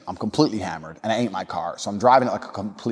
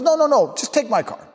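high, insistent put-on voice; old party guest; 12 semitones higher than usual. Old guy insisting a guest take his car, high put-on voice. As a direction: brush off the question in a pitched-up imitation. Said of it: "some old guy comes up".

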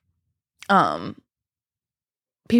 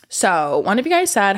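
normal talking voice

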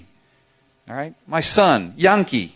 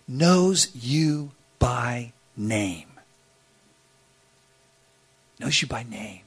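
quiet emphasis; 5 semitones lower, 7 dB quieter, much slower than usual. Real, not acted. Solemn repeated point.

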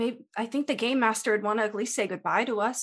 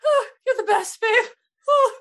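teary thanks; 10 semitones higher, 5 dB louder than usual. Acted.

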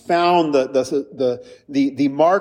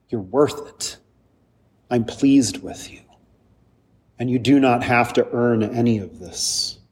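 1 Jesus speaking tenderly; 5 semitones lower than usual. Retold or imitated.

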